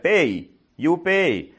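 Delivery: slow, high and loud, word by word; broken English demand. Retold or imitated. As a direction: push the bill at her in clipped broken English. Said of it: "So he's like".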